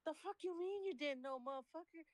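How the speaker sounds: high-pitched, incredulous comeback; indignant retort. Retold or imitated. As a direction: act out an indignant confrontation. Retold confrontation with whistling customer.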